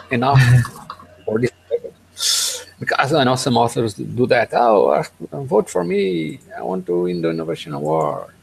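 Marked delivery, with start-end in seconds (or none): none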